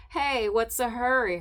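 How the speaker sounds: put-on jeering boy. Boy calls after her in park.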